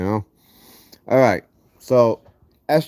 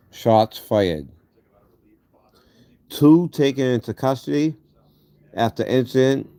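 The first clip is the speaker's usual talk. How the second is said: gauze in mouth. Real, not acted. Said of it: "so I still have gauze in my mouth".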